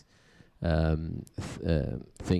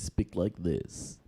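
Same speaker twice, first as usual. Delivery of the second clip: put-on close, airy old-radio announcer voice, a little softer; breathing into the mic; 2 semitones lower, 5 dB quieter, slower than usual. Shown as a demonstration. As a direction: breathe the words right into the microphone like an old radio host. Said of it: "breathe into the microphone".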